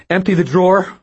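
high, loud robber demand. A narrator's reading. Teen robber orders cashier loudly, demanding.

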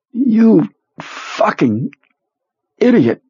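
high, slow gasped curse through pain; gasped insult. Acted. Sick man gasping insult at his boss, high and slow. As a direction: spit it out between gasps of pain. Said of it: "Perlmutter gasped".

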